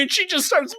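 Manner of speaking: ordinary talk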